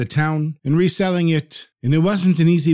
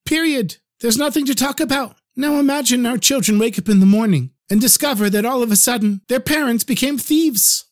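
firm wife; 8 semitones higher than usual. Acted. Wife firmly refusing stolen money.

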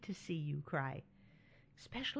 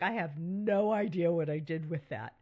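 mock bewildered, a bit louder; baffled quote; 4 dB louder than usual. Admitting to listeners she can't find things, mock bewildered. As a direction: act lost about where it went. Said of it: "you like".

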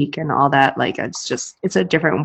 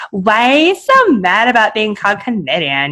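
mock-indignant Canadian, slightly higher pitch; hammed-up Canadian commenter; Canadian accent; 3 semitones higher than usual. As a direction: put on an aggrieved Canadian accent. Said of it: "that was a perfect Canadian accent".

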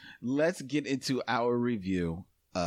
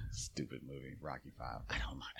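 whisper; 13 semitones lower, 14 dB quieter than usual. Real, not acted. Trailing aside.

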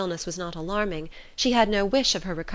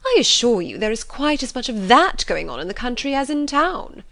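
higher, louder, swooping indignation; offended matron; 6 semitones higher, 6 dB louder than usual. A narrator's reading. Mother rebuts the proud gentleman, offended. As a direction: insist huffily, leaning hard on key words. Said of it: "offended by his manner".

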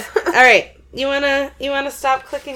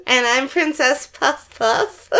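laughing; 4 semitones higher, slower than usual. Acted. Announcing silly nickname.

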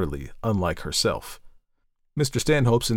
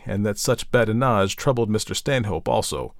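ordinary delivery, nothing marked

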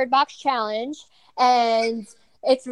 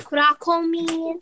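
put-on slow demo for a toddler; 5 semitones higher, slower than usual. Shown as a demonstration.

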